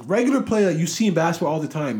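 high, loud rush of words; excited. Real, not acted. Telling viewers about the play, excited and loud. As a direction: blurt it out, worked up and fast.

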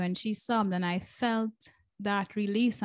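ordinary talk, nothing marked

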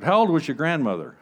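ordinary delivery

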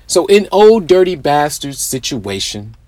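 ordinary delivery

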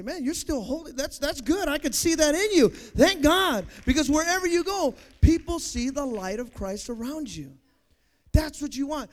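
loud, rising preacher's cry building up; full-throated sermon climax; preaching at full force. Acted. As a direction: lift it and preach it out loud to the congregation.

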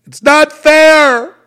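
high, drawn-out protesting shout; long, loud wailing yell; screaming and yelling. Acted. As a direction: scream it out slowly at full volume, acting out a dying protest. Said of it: "you'll die screaming and yelling".

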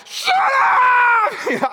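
high, loud yell; shout at the dog. Retold or imitated. Kept awake, yelling at the snoring dog. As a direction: bark it at the noisy dog. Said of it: "I'm like".

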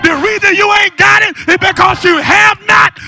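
loud preacher climbing into high, sustained shouts; fervent shouted preaching, key words drawn out; full-force preaching. Real, not acted. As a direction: cry out to God and rouse the congregation.

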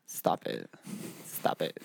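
soft, voiceless "stop it"; mock scolding whisper. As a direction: whisper a playful telling-off.

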